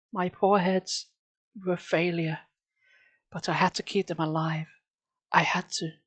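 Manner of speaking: lightly voiced, hushed and bitter shopkeeper; a hint of a grieving maker, half whispered; understated sorrowful old store owner.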